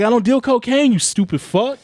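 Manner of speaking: loud, high-pitched indignation; retold angry rebuke. Guest retelling his answer to cocaine request, angry rebuke. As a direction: blow up at him in the retelling.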